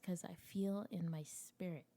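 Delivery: breathy hushed aside. Retold or imitated. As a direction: whisper it like a secret premonition. Telling Joe baby's coming.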